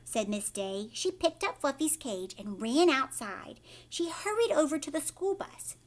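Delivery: ordinary delivery, nothing marked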